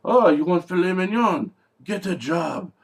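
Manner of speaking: high, loud mock indignation; French chef's retort. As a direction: scoff loudly as the offended chef. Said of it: "And the French guy says".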